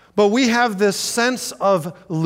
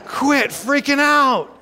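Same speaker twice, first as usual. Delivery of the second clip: high, loud, slow quit freaking out; hammed-up sharp rebuke; mock blunt rebuke; 9 semitones higher, 5 dB louder, slower than usual. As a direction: snap them out of panic, playfully. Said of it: "That's like".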